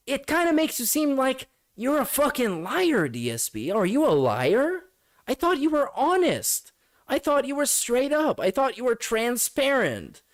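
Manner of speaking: high, mocking sing-song; sarcastic taunt. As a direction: feign shock in a high voice.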